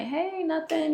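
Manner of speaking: bright, high fake-casual hey; forced cheer hiding anger; fake cheerful. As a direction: cover being upset with a chirpy hello. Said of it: "just trying to hide that I'm upset".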